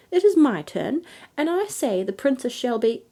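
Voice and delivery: high, loud insistence; insistent. Acted. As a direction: push over him, getting cut off. Fairy insisting, cut off.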